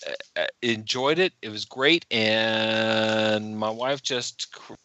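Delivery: normal talking voice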